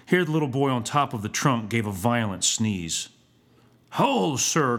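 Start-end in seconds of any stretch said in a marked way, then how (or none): 3.9-4.8 raised, stern schoolmaster challenge; sharp challenge to a pupil; growled. A narrator's reading. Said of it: "growled the schoolmaster".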